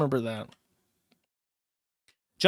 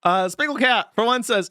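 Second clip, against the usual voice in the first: ordinary delivery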